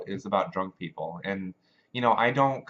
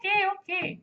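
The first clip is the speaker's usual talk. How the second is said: put-on piercingly high, loud Spanish-accented kid; squealed realization in a little Spanish accent; kid's Spanish accent; 15 dB louder than usual. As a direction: imitate a thrilled child with a Spanish accent. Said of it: "they have that Spanish accent".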